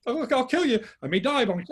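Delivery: a frightened man daring the woods to come at him; terrified bravado. Retold or imitated. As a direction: yell scared defiance at an unseen attacker. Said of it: "but I was so terrified".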